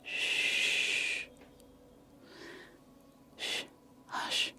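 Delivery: playful shush; soft shushing sound. Pretend. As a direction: hush yourself with a soft shh. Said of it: "Can you tell me to be quiet?".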